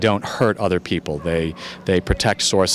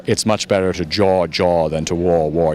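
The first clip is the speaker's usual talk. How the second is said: put-on a light British accent on the Churchill line; a mild British accent, quoting Churchill. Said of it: "You have to say with a British accent".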